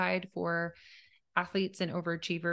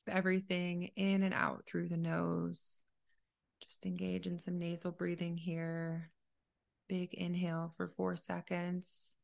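softer, even meditation voice with long pauses; calm, unhurried meditation leader; guided meditation; 4 dB quieter than usual. Acted. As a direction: gently guide a listener through breathing, leaving space between phrases.

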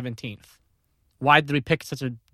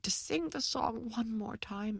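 faint pleading; 6 semitones higher, 18 dB quieter, slower than usual. Retold or imitated. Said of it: "she would sit there and say".

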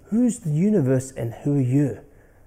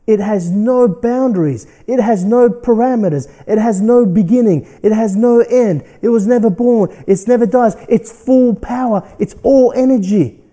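preaching; 6 semitones higher, 8 dB louder than usual. Acted. Building sermon declaration.

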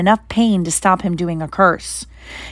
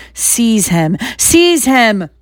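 shrieked order; 3 semitones higher, much slower than usual. Acted. Voldemort shrieks again at Quirrell to seize Harry. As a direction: repeat the command, rising to a shriek. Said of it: "shrieked Voldemort again".

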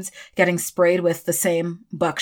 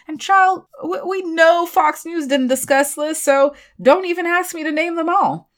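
sassy aside; 10 semitones higher than usual. Real, not acted. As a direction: toss it off with sass. Sassy aside, raised pitch.

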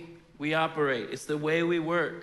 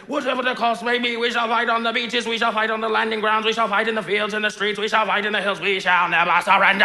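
louder, higher rallying oration; rousing movie Churchill; 4 semitones higher, 6 dB louder, faster than usual. Retold or imitated. Pastor acting the film's Churchill, loud rallying speech. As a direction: build to a roaring climax. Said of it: "the Darkest Days movie, he does all this stuff".

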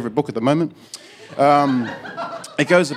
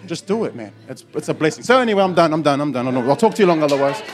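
normal talking voice

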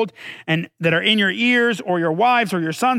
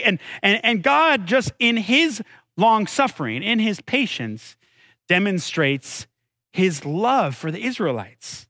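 ordinary delivery

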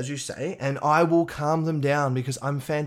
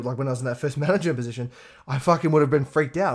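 ordinary delivery

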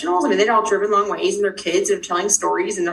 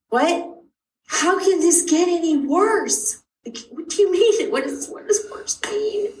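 rising, much higher, pleading disbelief; alarmed disbelief; 9 semitones higher than usual. Retold or imitated. Mother pressing the nurse about worse, rising alarm. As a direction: climb higher with each question. Told he'll get worse.

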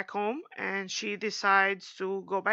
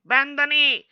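louder, higher hammed-up command; mock royal demand; 5 semitones higher, 7 dB louder than usual. Daenerys demanding Jon bend the knee, mock loud command. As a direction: bark it like a haughty queen.

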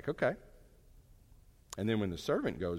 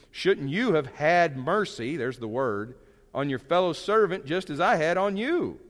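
stern king rebuke; 5 semitones higher, 6 dB louder, slower than usual. Acted. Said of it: "He said, "You wicked servant".

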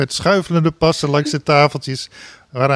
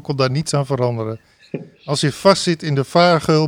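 ordinary delivery

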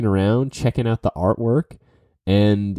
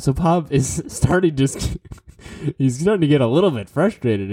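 narrating through a real laugh, higher; 6 semitones higher than usual. Real, not acted. Telling how the buyer starts getting frustrated, laughing. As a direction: let a chuckle break into the telling.

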